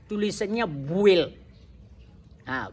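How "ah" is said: ordinary talk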